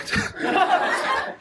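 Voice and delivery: a short, high laugh on the breath; real high laughter; high breathy laugh. Real, not acted. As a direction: burst into laughter. Laughing at strike me.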